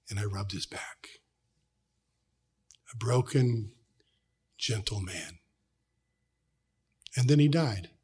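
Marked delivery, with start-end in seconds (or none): 0.1-5.4 tender whisper. Real, not acted. Tender memory of dying father.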